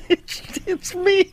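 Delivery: high, breathy laugh through the words; can barely get it out for laughing. Real, not acted. Cracking up at the dummy bit.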